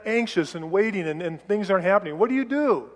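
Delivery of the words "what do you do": normal talking voice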